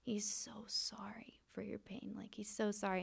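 soft, breathy retelling of a weeping prophet, mostly whispered; retold crying plea. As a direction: pass on his tearful apology under your breath. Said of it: "starts hysterically crying".

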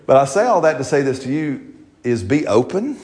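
ordinary delivery

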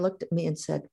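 normal talking voice

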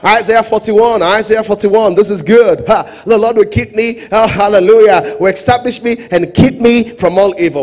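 loud, driving preaching over the organ; full-force preaching. Acted. Pastor rallying the congregation over music, preaching at full force.

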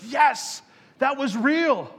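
excited cheer of approval. Acted. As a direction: burst out in glad approval. Reacting to a kid's honest prayer.

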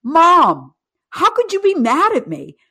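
high, happy voice of a grinning son; son's gleeful voice. Retold or imitated. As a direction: beam at mom, unbothered. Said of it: "And he goes".

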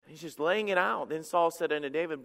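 ordinary talk

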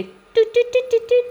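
high beeping modem imitation; dial-up modem noise. Imitating her computer logging on like an old dial-up modem. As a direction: mimic an old dial-up connecting. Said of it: "Didn't that sound like the old dial-ups".

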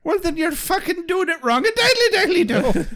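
high-pitched, silly goody-goody voice; mock folksy scold. Scolding a friend for being a heathen, mock folksy. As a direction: squeak it out in a cartoonish sing-song.

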